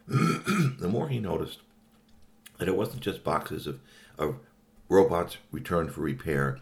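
plain reading voice

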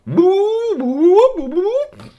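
very high, loud hooting yelps; startled alien cry. Acted. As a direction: cry out like a creature jolted awake. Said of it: "he was jerked away".

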